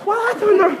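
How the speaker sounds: high, whiny voice of a picky husband; complaining husband. Retold or imitated. Uncle complains to his new wife about her cooking, high and whiny. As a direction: pitch it up and grumble about the food.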